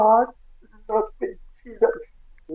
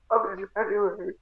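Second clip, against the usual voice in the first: ordinary delivery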